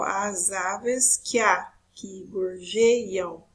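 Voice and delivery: very slow, drawn-out syllables. Shown as a demonstration. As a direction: scan the verse slowly, stretching each syllable. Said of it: "o outro exemplo".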